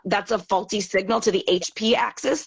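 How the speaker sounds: normal talking voice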